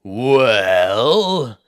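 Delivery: slow booming giant voice; a giant sizing up tiny folk; ponderous cyclops. Acted.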